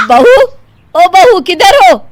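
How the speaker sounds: loud, high, slow call across the house; drawn-out loud call. Acted. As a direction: call for the bride from another room. Mother-in-law calling daughter-in-law.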